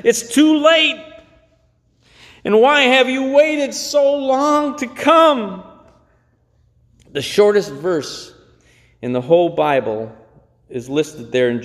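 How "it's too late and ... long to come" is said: high, pleading mock cry; tearful Martha. Preacher voicing weeping Martha reproaching Jesus, high and pleading. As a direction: voice Martha's grieving complaint up high. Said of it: "Martha came to Jesus crying".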